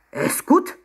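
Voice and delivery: high, loud, outraged question; indignant cry. A narrator's reading. Secretary repeats the insult to Emma, indignant cry. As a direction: echo it in rising disbelief. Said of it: "rief er empört".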